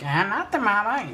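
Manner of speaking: put-on high, creepy little baby voice; squeaky creepy toy baby. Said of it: "He had a creepy little voice".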